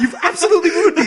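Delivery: high, loud exasperated outburst, breaking into laughter; mock frustrated rant. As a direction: rant like a scandalized anime boy. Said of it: "he gets so mad".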